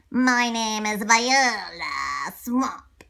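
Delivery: slow scratchy witch-like voice. Acted. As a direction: rasp it slowly, menacing. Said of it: "in a scratchy voice".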